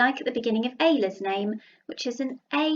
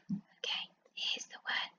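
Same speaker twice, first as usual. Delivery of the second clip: whisper; 16 dB quieter than usual. Real, not acted. Teacher reveals tricky word.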